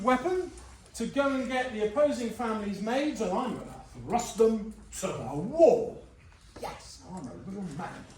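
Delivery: a lightly hammed-up swaggering lad; swaggering Samson. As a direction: ham up a cocky young thug bragging. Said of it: "Samson's like".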